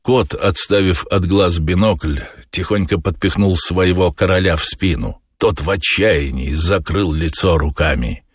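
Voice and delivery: usual speaking voice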